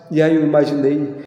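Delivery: ordinary talk, nothing marked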